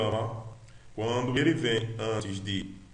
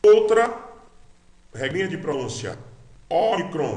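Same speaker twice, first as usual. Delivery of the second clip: usual speaking voice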